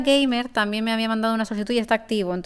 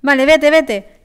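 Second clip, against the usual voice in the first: urgent call; 4 semitones higher, 6 dB louder, slower than usual. Real, not acted. Urging teammate to leave.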